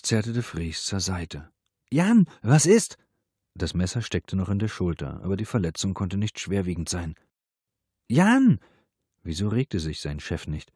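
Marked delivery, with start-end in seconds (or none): 1.9-3.0 high, loud, drawn-out call; frightened raised voice; alarmed. A narrator's reading. Shouting at his wounded boss. 8.1-8.6 very high, loud shout of a name; desperate, high-pitched call; alarmed cry. A narrator's reading. Calling his unresponsive boss again.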